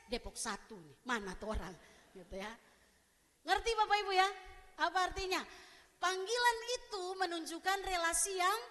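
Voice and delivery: ordinary talk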